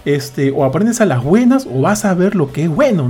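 padre harto, actuado con tono más alto; regaño imitado. Retold or imitated. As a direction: imita una advertencia enfadada al hijo. (regaño de padre harto)

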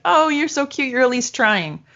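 high-pitched fond cooing; mock indulgent. The French to a tourist making an effort, indulgent. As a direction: coo it as if charmed by the attempt.

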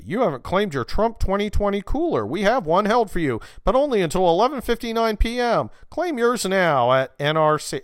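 hammed-up cheap ad pitch; mocking promo text. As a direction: read the text like a pushy ad. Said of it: "what's it say".

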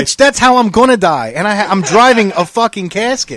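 high, loud playful rant; cackle-ready riff about his car; joking outburst. Real, not acted. Joking to his friend about his car, loud playful rant.

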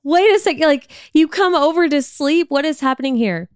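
irritated mock rant at mom; retold angry outburst. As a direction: replay the moment you lost patience. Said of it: "And I'm getting pissed".

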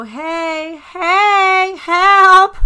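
loud, high drawn-out yells; calling for help. Acted. Woman acting out being stranded with a flat, shouting for help. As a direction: holler out for roadside rescue. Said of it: "I'll just go".

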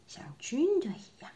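usual speaking voice

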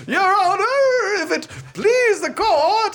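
put-on slow, high-pitched southern drawl accent. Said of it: "hard thing to do with a southern accent".

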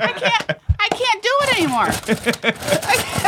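high, slow words through helpless laughing; can't get it out for laughing; real laughter. Real, not acted. Host cracks up at the plastic knives story, laughing.

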